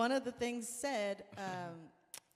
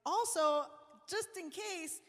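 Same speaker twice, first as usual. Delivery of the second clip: higher, eager, a bit sly; eager offer; 7 semitones higher than usual. Retold or imitated. Host slyly offering the singer her drink, higher. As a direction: dangle the offer brightly. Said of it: "I was like".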